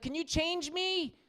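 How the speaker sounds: put-on slow, high little-kid request; mock toddler asking Dad for help; imitating toddler. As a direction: draw it out in a child's high voice.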